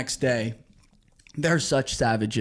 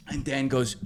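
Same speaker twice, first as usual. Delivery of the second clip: usual speaking voice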